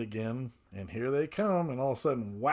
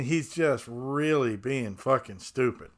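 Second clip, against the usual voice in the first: usual speaking voice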